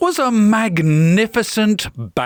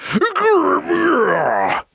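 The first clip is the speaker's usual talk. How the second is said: higher, hammed-up growl; mock snarl; 7 semitones higher than usual. A narrator's reading. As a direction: growl like a sore loser. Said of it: "snarled Sir Roderick".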